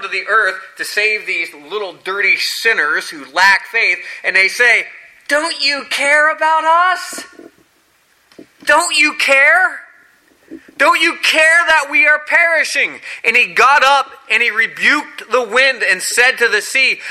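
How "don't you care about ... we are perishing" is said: mock the frightened disciples' complaint; whiny panicked disciples. Disciples to sleeping Jesus in the storm: high accusing whine.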